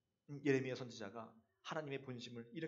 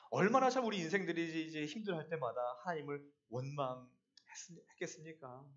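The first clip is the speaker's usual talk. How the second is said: ordinary delivery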